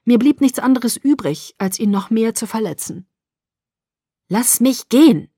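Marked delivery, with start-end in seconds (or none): 4.3-5.3 slow, loud, final demand; drawn-out, upset insistence; hard demand. A narrator's reading. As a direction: push the words out with force. Daughter wounds father to leave.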